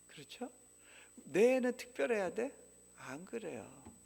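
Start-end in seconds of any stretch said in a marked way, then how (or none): 1.2-2.5 mocking question. Mocking parents' expectations.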